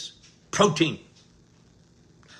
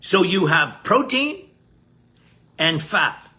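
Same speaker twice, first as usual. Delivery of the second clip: ordinary talk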